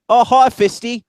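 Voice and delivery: high, loud hammed-up Aussie; mock Australian accent. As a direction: squawk it out as an Aussie, mockingly. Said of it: "put on my best Australian accent".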